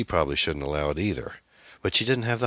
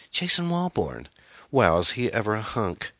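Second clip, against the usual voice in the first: excited daughter; 6 semitones higher than usual. A narrator's reading. Daughter names her date.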